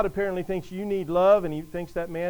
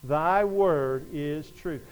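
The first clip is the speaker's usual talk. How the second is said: very slow, lower, word by word; slow group recitation; 4 semitones lower, much slower than usual. Shown as a demonstration. Preacher leads congregation saying it together, slow and deliberate. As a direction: lead a congregation through it slowly. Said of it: "Would you say that with me?".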